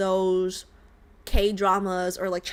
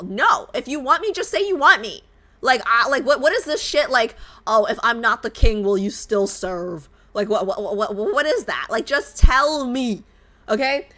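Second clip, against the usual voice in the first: louder, insistent rant; fed-up demand; 2 semitones higher, 5 dB louder than usual. Real, not acted. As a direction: demand a straight answer, fed up. Angry at love interest's vagueness.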